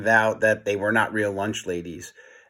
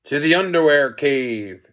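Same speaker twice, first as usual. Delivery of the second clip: ordinary delivery, nothing marked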